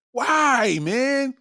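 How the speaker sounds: ordinary talk, nothing marked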